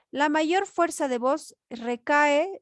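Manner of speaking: normal talking voice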